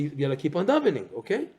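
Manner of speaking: ordinary talk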